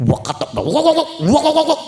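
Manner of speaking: high cackling hen squawks; mock chicken cackle; chicken clucking.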